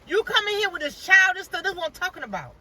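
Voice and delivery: high mock scolding, trailing down at the end; imitated hollering. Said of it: "She was hollering at her".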